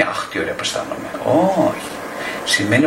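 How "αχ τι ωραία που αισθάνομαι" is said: breathy unvoiced mock bliss; dreamy mock sigh. Therapist mocking a blissful client, whispered. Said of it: "δεν σημαίνει".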